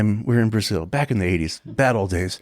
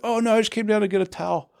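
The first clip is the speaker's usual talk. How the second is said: high-pitched caught-out fibber; mock-innocent excuse; 11 semitones higher than usual. Singer caught in the hotel lobby, high mock-innocent excuse. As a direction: imitate someone bluffing innocently, voice way up.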